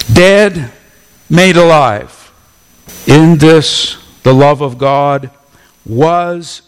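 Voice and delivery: loud, ringing "Dead, made alive"; preaching at full force. Acted. Preacher proclaiming new life, loud and forceful. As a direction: proclaim it loud to the whole church.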